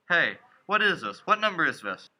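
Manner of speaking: loud, high, frantic questions; panicked. Acted. Son panicking on a long-distance call, frantic. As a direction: demand answers in rising panic.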